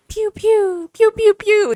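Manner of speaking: high, sharp mouth-made laser pews; squeaky pew pew ad-lib imitation; pew pew sound effect. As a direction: fire off little toy-gun noises.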